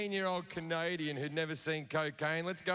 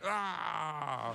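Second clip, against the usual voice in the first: fake orgasm grunt; 2 semitones lower than usual. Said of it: "pulled out and went".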